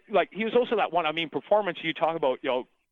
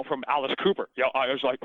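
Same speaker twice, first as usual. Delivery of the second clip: ordinary talk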